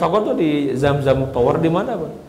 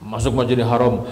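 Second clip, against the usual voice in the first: ordinary talk, nothing marked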